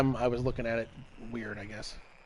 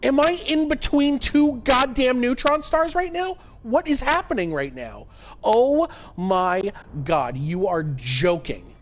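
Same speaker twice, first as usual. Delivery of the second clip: high, loud, incredulous; can't believe your eyes; disbelieving shout; 10 semitones higher, 6 dB louder than usual. Real, not acted. Streamer realizing he's between neutron stars, excited shout.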